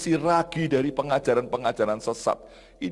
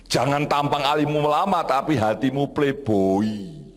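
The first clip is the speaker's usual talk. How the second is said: forceful, stretched-out admonition; emphatic warning; 3 dB louder, slower than usual. Retold or imitated. Pastor voicing the reverse as a warning, emphatic admonition. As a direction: drive it home, drawing out the last phrase.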